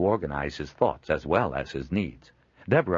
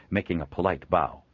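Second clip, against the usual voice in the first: ordinary delivery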